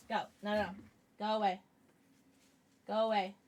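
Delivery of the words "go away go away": normal talking voice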